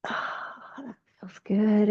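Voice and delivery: breathy sigh of pleasure, barely voiced; soft relieved whisper. Real, not acted. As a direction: whisper it with relief while stretching. Pleased sigh during a stretch.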